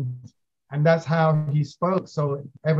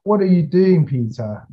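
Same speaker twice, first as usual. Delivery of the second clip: put-on Thai accent; 5 semitones higher, 6 dB louder than usual. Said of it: "in a Thai accent".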